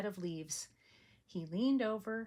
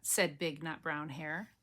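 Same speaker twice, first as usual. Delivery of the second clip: ordinary delivery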